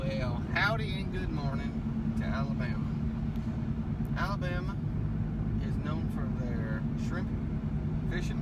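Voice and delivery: drawled, soft southern howdy voice; folksy southern host. Pretend. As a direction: play a down-home Alabama greeter, quietly. Asked what Alabama is known for.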